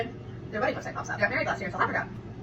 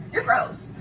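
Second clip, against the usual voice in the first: disgusted retort; 4 dB louder than usual. Retold or imitated. Telling off the cheater.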